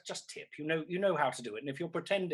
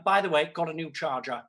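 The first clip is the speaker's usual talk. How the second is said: usual speaking voice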